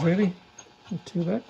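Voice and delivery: ordinary talk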